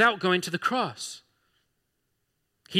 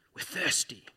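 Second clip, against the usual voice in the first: lightly voiced grumbling crowd; complaining Israelites; 6 dB quieter than usual.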